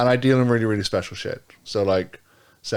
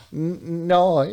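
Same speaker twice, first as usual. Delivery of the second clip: imitated Aussie NO, higher and drawn out; mock Australian accent; 6 semitones higher than usual. Imitating a woman from the show, attempted Australian accent. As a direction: mimic an Australian woman's NO, pitched up. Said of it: "She's like".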